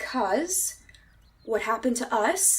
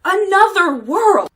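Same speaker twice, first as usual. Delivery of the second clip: louder, insistent cry; emphatic; 7 semitones higher, 6 dB louder than usual. Acted. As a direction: press the point hard. Woman insists, rising emotion.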